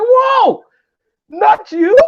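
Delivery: high, loud startled whoa; shocked disbelief. Retold or imitated. Comic meeting the finance minister, high startled disbelief. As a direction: jump up in surprise.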